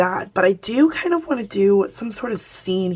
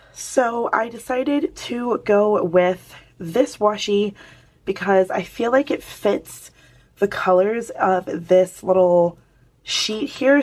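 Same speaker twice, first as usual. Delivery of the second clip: ordinary talk, nothing marked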